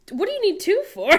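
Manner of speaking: high, loud disbelieving question; can't believe there are two; incredulous at two Spencers stores nearby. Real, not acted.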